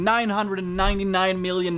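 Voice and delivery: normal talking voice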